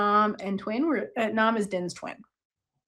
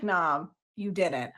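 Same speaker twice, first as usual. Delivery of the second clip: dismissive dad; 5 semitones lower, 6 dB quieter, slower than usual. Retold or imitated. Dad voiced, disbelieving.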